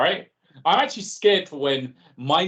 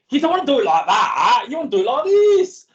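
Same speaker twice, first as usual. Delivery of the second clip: higher and louder know-it-all sneer, rising as it goes; know-it-all TV character; 7 semitones higher, 7 dB louder than usual. Retold or imitated. To the group, voicing his inner critic as a smug know-it-all, high and loud. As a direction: lampoon a smug sketch-show character who poo-poos every idea. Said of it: "I give him that accent".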